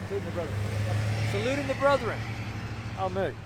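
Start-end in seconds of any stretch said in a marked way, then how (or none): none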